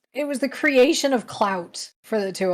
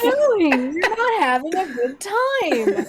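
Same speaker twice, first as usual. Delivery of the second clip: high, slow whiny voice; high mock whine; 12 semitones higher, 4 dB louder than usual. Mocking the two organizers.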